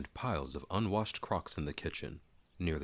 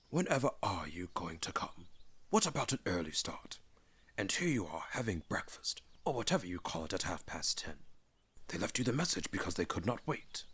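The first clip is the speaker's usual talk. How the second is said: put-on old wizard, half-whispered chiding; Gandalf hushed; 2 semitones higher, 3 dB quieter than usual. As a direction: murmur the reproach like a secret. Gandalf voiced in reading.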